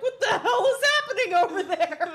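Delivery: high, loud laughing mock panic; imitating panic. Host imitates co-host panicking over the flashing lights, laughing. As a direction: tease her friend's freak-out. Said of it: "You did really start panicking".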